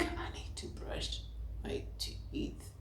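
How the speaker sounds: slow, faint, drugged whisper. Retold or imitated. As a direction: barely get the words out, whispering groggily. Imitates herself groggy on Pethidine.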